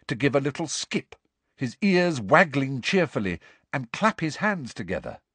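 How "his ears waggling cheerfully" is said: plain reading voice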